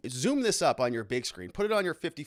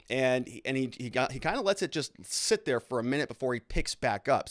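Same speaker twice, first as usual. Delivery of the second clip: ordinary talk, nothing marked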